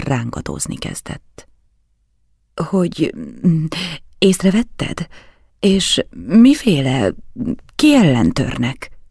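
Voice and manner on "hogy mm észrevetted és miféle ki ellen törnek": halting, stretched-out questions, pitch jumping high; flustered stammer. A narrator's reading. Count asking his guest who threatens him, stammering. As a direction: stammer it out, stalling between words. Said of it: "hebegte elvörösödve".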